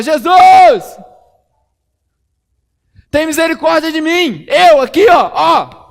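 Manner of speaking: very high, very loud desperate yell; full shout. Acted. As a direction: scream his name over the crowd. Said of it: "mais alto ele gritava".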